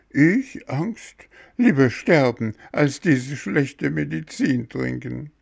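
put-on high-pitched, scornful child's refusal; stubborn puppet boy, loud at first; defiant. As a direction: scoff at the danger in a high boy's voice.

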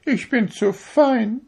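high, proud little needle voice; a needle showing off how fine it is; vain darning needle. A narrator's reading. To the fingers, a vain needle in a high proud voice. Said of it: "seufzte die Stopfnadel".

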